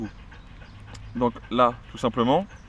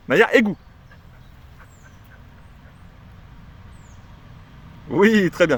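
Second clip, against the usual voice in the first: high praise to dog; 8 semitones higher than usual. Real, not acted. Trainer sending Naya to the drain then praising her, high. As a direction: lift the voice to reward her.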